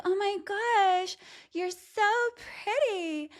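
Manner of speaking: very high, slow, syrupy gushing; hammed-up fake compliment, sugary and drawn out. Host mocking a salesperson's fake flattery, sugary and high. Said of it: "someone's like".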